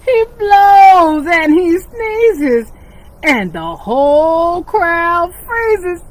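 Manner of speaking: lively storybook reading for little ones; animated picture-book narration; reading to children. A narrator's reading. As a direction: read it like storytime with kids.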